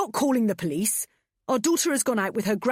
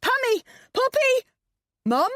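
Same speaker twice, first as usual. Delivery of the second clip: high frantic calling out; shouting names across the flat; 9 dB louder than usual. A narrator's reading. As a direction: call out through the house in alarm. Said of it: "shouted Adam".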